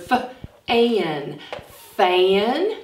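f-an then fan; f sound. Shown as a demonstration. Teacher showing children f-an then fan. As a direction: stretch the f then blend fan. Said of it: "we have the f sound".

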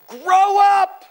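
sudden loud high shout; preacher yells to his congregation to grow up; shouted command. Acted. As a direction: bark it out like a stern preacher. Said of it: "That's what he says".

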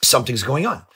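ordinary talk, nothing marked

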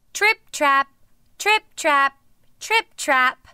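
"Trip-trap" punched loud in separate beats; heavy steps. A narrator's reading. Biggest goat crossing the bridge, "Trip-trap" stamped heavily. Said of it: "billy goat was so heavy".

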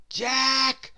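calling Jack from under a hat. A narrator's reading. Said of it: "he called from underneath the hat".

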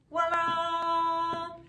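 high, long-held "ta-da" flourish; singsong reveal. Real, not acted. Potter unveiling the moulded plate, singsong flourish. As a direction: draw it out slowly on a high, sung note.

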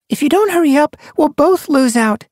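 high, loud hurried she-cat; urgent mew. A narrator's reading. Sun Paw urges her friend, high and loud. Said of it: "she mewed".

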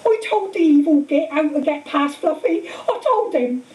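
high, distraught wail, acted; sobbing. A narrator's reading. Hagrid confessing he told about Fluffy, sobbing. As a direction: howl with remorse through acted tears. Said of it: "he sobbed, his face in his hands".

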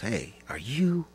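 slow, hesitant retold question; tentative approach. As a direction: draw it out as a curious stranger unsure. Old stranger's hesitant question, retold.

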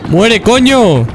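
high, slow, drawn-out curse; furious at an enemy that won't die; frustrated yell. Real, not acted. (grito de rabia)

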